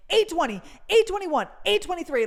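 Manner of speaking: high, loud rapid number calls; calling numbers. Retold or imitated. Guard calling a batch of ticket numbers. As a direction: rattle off the numbers loudly.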